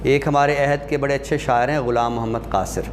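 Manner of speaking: ordinary talk, nothing marked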